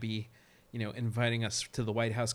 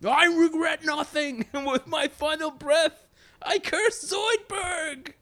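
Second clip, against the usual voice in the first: high, slow defiant last words; overacted death scene; 14 semitones higher, 5 dB louder, slower than usual. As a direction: ham up a martyr's final curse. Last words before execution.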